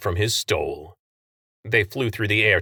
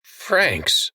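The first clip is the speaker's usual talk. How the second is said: raised, pleading call of her name; imploring; 7 semitones higher than usual. A narrator's reading. Stan imploring Franks, raised and higher. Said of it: "Stan implored".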